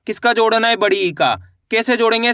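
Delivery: ordinary talk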